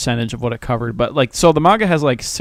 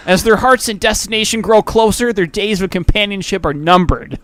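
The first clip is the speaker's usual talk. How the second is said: epic movie trailer announcer; solemn, dramatic blurb voice, pitched up; trailer narrator voice; 6 semitones higher, 3 dB louder than usual. Acted. Dramatic anime blurb read.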